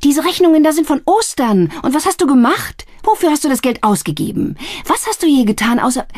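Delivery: high, loud furious tirade; raging. A narrator's reading. Sister raging at her brother over old bills. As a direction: tear into him. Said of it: "wütete sie".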